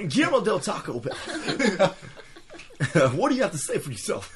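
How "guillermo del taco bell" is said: fake Spanish accent. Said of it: "That's not Spanish enough".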